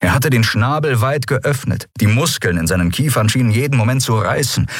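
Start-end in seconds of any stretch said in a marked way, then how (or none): none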